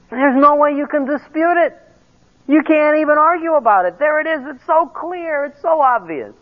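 high-pitched, emphatic case-making; insistent arguing. Acted. Believer laying out an airtight case to a doubter, high and insistent. Acting out an airtight argument to somebody.

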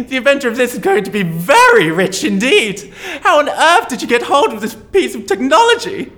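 ordinary talk

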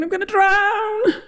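very high, panicky wail; groaning. A narrator's reading. Jonah about to drown, groaning. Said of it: "Jonah groaned".

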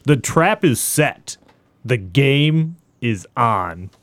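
slow, drawn-out movie tagline read. Acted. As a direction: read it like a trailer tagline, pausing between phrases. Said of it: "like, a tagline voice".

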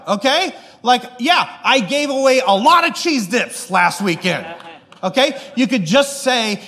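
retold flare-up in front of the whole kitchen; angry outburst. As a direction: blurt it out at the boss, fed up and defensive. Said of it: "I'm getting angry".

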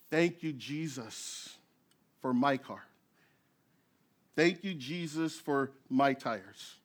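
soft, slow grateful prayer to Jesus; hushed thanks. Retold or imitated. Driver near the crash thanks Jesus for his tires, softly and slowly. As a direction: breathe out thanks quietly and slowly. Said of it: "the first thing that I thought".

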